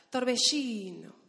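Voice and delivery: "Torbellino" loud and drawn out, ll as an airy sh; stretched Argentine sh. Shown as a demonstration. Teaching the room to talk Argentine, stretched sh. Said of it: "tienes que estirar las palabras".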